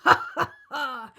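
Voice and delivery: breathy wicked laugh; gloating crocodile laugh. Acted. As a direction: cackle with glee.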